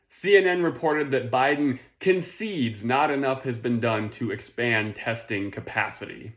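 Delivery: normal talking voice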